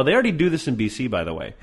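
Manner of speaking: usual speaking voice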